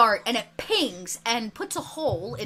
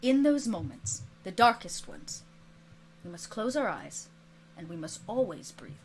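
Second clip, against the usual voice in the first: hushed mentor's counsel, sinking lower; calming teacher; 4 semitones lower, 7 dB quieter than usual. Acted. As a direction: soothe a panicked student quietly. Teacher calms panicked student.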